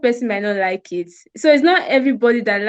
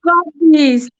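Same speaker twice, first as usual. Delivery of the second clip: higher, louder, slow affirmation; strong and loud; 4 semitones higher, 3 dB louder, slower than usual. Real, not acted. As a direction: say it as strong as you can. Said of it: "as loud as you can".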